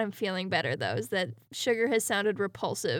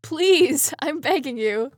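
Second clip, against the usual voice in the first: mock pleading; 2 semitones higher, 6 dB louder, slower than usual. Begs the weather to stop.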